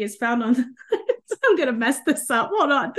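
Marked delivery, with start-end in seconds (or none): 0.9-1.4 short, high self-conscious laugh; embarrassed laugh. Real, not acted. Laughs as she muddles the quote. 1.4-2.9 flustered, laughing through the slip; laughing at oneself. Real, not acted. Laughing at her botched quote.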